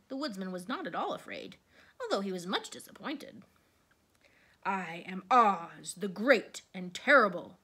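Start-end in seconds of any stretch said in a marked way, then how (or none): 4.6-7.6 loud, slow booming beast; a mighty beast announcing itself loudly; great roar. A narrator's reading. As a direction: roar it out slowly, drawing out the words. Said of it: "in a voice that was like one great roar".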